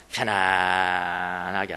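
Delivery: low, soft, long-stretched vowel; drawn-out comfort. Shown as a demonstration. Preacher illustrates settling into a seat, drawn out and low. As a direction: stretch the word out slowly, settling down into it.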